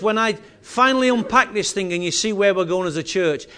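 ordinary talk

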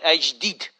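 Scottish accent, "deed" for dead; Scottish pronunciation. Shown as a demonstration. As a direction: stress "as", then Scottish "deed". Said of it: "has more of an emphasis on it".

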